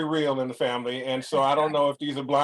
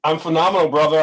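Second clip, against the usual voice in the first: excited; 3 semitones higher, 9 dB louder, faster than usual. Real, not acted. Enthusiastic greeting.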